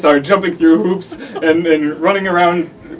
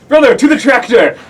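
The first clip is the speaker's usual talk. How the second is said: shouting orders, higher and louder; yelling; 9 semitones higher, 10 dB louder than usual. Acted. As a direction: call out to brother across the yard. Yelling to brother in story.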